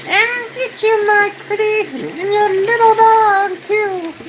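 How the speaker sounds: very high, slow squeaky cackling witch; squeaky witch impression. Streamer retries the witch quote in a very high squeaky voice. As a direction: shriek it like a storybook witch. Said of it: "that was horrible, too".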